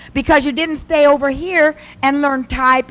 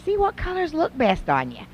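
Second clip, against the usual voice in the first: much softer, encouraging tone; gentle mother; 3 semitones higher, 7 dB quieter than usual. Retold or imitated. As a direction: coax your daughter kindly. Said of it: "then we can say".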